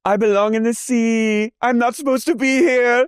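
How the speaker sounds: mock mermaid. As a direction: float it like a lost mermaid. Joking mermaid voice of [name].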